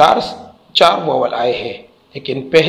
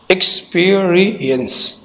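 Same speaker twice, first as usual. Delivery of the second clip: "Experience" slow and higher, in four beats, x, पी, रि, यंस; syllable by syllable; 5 semitones higher, slower than usual. Shown as a demonstration. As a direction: stretch out each syllable of "experience". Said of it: "चार syllable है".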